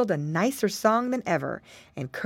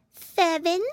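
high little pig voice; a small piglet answering; piggy boy voice; 10 semitones higher than usual. A narrator's reading. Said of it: "said Flop".

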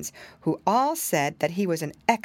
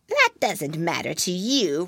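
sly witch voice. Acted. Witch rebuffs soldier.

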